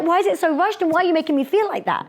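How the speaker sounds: high, rushed anxious listener voice. Retold or imitated. Audience member complaining the talk feels rushed, flustered. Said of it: "it starts feeling like I'm just like".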